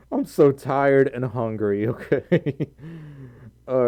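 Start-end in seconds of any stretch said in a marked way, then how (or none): none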